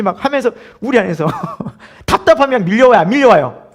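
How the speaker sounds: usual speaking voice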